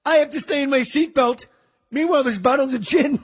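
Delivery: a Keitel impression as a washed-up eighties comedian; Harvey Keitel doing stand-up. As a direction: ham up a gruff actor doing club stand-up. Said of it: "that stupid Keitel voice".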